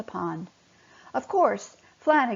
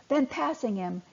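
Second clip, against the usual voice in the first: usual speaking voice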